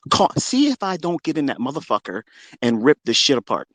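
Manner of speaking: ordinary delivery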